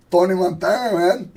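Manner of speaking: hint of a movie mobster; playful mock gangster voice; Al Pacino. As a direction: lightly imitate an old mob-film gangster. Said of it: "This is my impersonation of Al Pacino".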